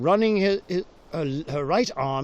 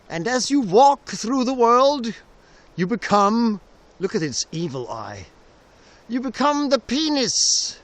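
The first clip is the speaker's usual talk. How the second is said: louder, grandiose fake guru delivery; mock profound proclamation; 6 semitones higher, 4 dB louder than usual. Vlogger rambles mock-profound wisdom about the world, loud and grand. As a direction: declaim it like a pompous mystic.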